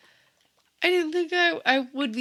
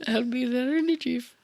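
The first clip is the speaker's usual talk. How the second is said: mock Irish accent. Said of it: "We're sounding Irish".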